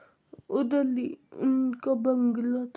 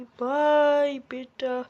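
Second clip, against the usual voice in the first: loud, stretched-out "bye"; called-out goodbye; 5 dB louder, slower than usual. Acted. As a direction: call a long goodbye to the child. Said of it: "बेटी को बाय बोलूँगी". (ज़ोर से, खींचकर विदा कहते हुए)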